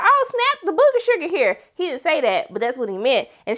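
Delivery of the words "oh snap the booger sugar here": high, excited surprise; very high mock delight. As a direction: spot the stash with glee. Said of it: "he pulled back like".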